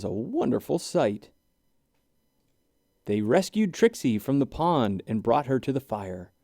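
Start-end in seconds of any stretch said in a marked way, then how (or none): none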